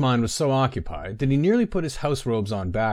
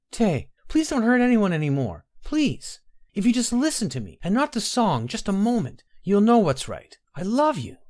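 much higher, held-back tearful plea; pleading young woman; 11 semitones higher than usual. Acted. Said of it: "stifled her sobs to keep her voice clear and calm".

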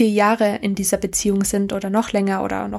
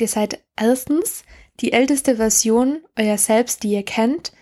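usual speaking voice

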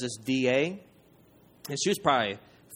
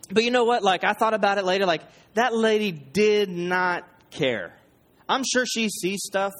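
louder, higher preaching push; 6 semitones higher, 4 dB louder than usual. Acted. Pastor presses the lesson on the congregation, raised preaching voice. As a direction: lift it up and drive the point home to the church. Preacher drives the point home.

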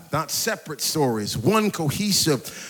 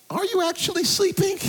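high-pitched, incredulous aside; mock disbelief; 14 semitones higher than usual. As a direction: jump up in pitch as if just catching someone out. Teasing aside about sleeping wife.

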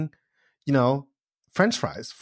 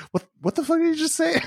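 mock bewildered; faster than usual. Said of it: "faces looking at me like".

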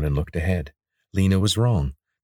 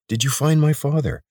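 put-on young man voice; 9 semitones higher, 4 dB louder than usual. Corvin asks urgently.

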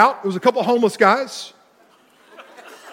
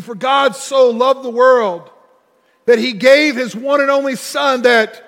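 slow, loud scripture quote with weight; preaching scripture; 5 semitones higher, 5 dB louder, slower than usual. Acted. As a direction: proclaim the verse slowly.

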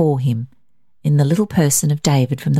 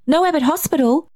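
put-on high-pitched child's outcry; alarmed child, voice shooting up high; distressed cry; 12 semitones higher than usual. A narrator's reading. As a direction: cry it out as a dismayed little boy. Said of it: "he cried".

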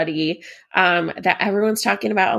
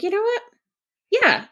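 high, sudden realization; mock realization; 13 semitones higher than usual. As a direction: squeak it out like a eureka. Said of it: "I'm like".

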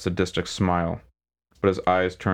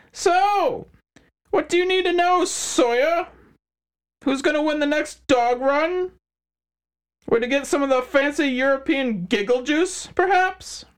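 smug crime boss; 3 dB louder than usual. Acted. McHines mocking questions.

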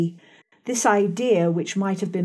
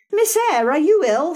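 high, louder anxious maid's voice; Bessie's worried question; 6 semitones higher, 4 dB louder than usual. A narrator's reading. Nursemaid Bessie finding the child screaming, high anxious voice. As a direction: voice the nursemaid rushing in, concerned. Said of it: "said Bessie".

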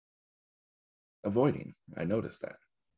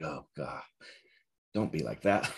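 eye-rolling self-scold. Retold or imitated. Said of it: "roll my eyes at myself".

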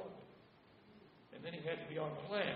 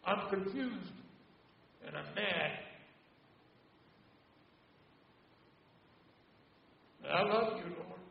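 1 confused and mad; 3 semitones higher, 8 dB louder than usual. Retold or imitated. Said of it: "And then he says".

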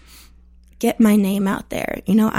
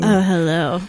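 sultry hello; 3 semitones lower than usual. Pretend. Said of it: "great sexy voice".

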